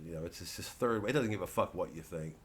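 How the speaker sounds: ordinary talk, nothing marked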